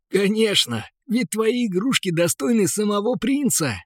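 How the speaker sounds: laughing. A narrator's reading. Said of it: "захохотал торговец".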